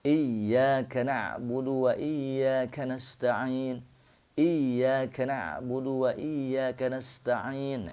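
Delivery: "Iyyaka" with the ya held doubled, slow and high; slow chant stressing the tasydid in "Iyyaka". Shown as a demonstration. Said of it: "ya-nya menggunakan tasydid".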